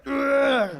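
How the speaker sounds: loud, high exhausted groan; tired cashier lifting water, loud groan; weary grunt. Retold or imitated. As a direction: heave out a defeated ugh.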